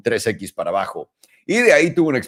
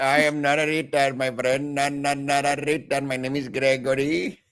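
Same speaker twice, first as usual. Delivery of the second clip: singsong, higher mock Indian accent; 4 semitones higher than usual. Playing the insulted friend, mock Indian accent. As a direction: protest in a put-on Indian accent.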